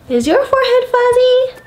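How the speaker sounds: high and fast teasing question; playful high question. Pretend. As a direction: tease the child playfully.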